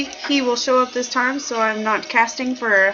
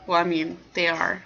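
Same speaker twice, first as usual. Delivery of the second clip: ordinary talk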